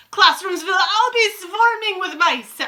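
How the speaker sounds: loud, high-pitched witch proclamation; witch's shout. A narrator's reading. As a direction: shout it out to the coven. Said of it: "shouted the Grand High Witch".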